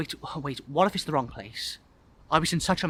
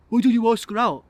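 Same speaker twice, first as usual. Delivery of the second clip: higher, louder lad; boy's voice; 8 semitones higher, 7 dB louder than usual. Acted. Boy urging guest to approach old crush, child's voice. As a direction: prod him like a keen schoolboy.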